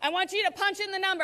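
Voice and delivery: ordinary talk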